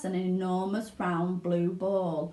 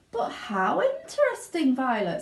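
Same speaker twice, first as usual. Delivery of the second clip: high, gushing mother; Mrs Beauregard; 6 semitones higher than usual. Acted. Mother praises Violet proudly, gushing. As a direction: fawn over her daughter. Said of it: "said Missus Beauregard".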